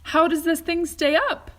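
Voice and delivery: a nervous question high in the sky; a touch of alarm; anxious cry. A narrator's reading. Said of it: "cried Grandpa Joe".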